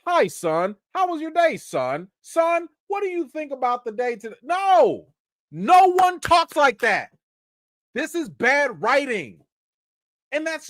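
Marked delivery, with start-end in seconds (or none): 0.1-4.4 stilted, formal dad talking to his son; a hint of a stiff, overly formal father; mock stiff parent. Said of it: "For those of y'all that got kids". 4.4-7.1 high, loud, slow exasperation; No, no one talks like that, fed up; stressed NO, slow and loud; exasperated outburst. Real, not acted. Ranting about bad writing.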